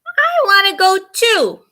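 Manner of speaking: put-on high, loud childish wail; whiny scream. A narrator's reading. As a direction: wail it like a little sister who wants to come along. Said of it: "Sana screams".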